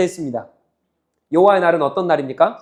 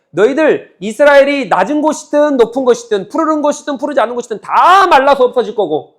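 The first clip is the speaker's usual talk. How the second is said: judgment; 6 semitones higher, 6 dB louder than usual. Acted. Voicing God's judgment.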